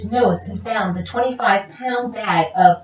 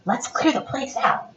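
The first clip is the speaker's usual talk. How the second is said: whispering; 2 semitones higher, 7 dB quieter than usual. Acted. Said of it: "whispered the first burglar".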